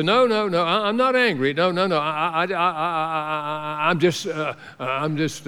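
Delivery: stammering, mock-defensive denial; a counselee insisting they are not angry, tripping over words; flustered denial. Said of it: "And they'll say".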